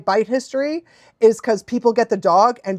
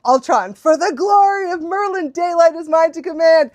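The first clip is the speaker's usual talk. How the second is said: lightly hammed-up battle cry, pitched high; heroic catchphrase; 7 semitones higher than usual. Fan reciting Trollhunters catchphrase, mock-heroic. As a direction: proclaim it like a hero's oath.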